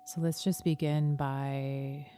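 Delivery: slow, calm meditation voice over music; guided meditation. Acted. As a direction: guide them gently inward, taking your time.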